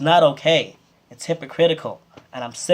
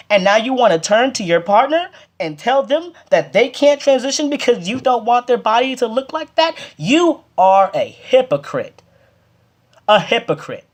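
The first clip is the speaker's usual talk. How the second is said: heated; 7 semitones higher than usual. Real, not acted. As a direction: let the frustration boil over, pitched up. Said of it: "I'm sorry I'm a little heated with this".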